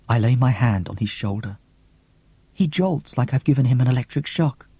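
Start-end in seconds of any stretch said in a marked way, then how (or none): none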